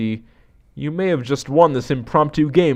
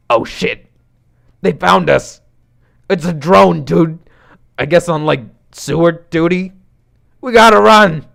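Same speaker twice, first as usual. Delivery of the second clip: alarmed buddy, louder and higher, building to a shout; panicked warning; 7 semitones higher, 7 dB louder than usual. Acted. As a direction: raise the alarm, urging escape. Character panics as drone approaches.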